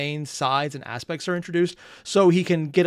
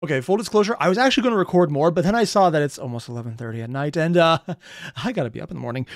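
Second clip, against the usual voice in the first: ordinary delivery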